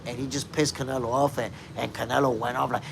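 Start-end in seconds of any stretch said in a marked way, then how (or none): none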